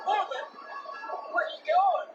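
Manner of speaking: high timid dog voice, surprised; timid sidekick dog. Acted. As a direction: squeak it out in disbelief. Drover's high voice.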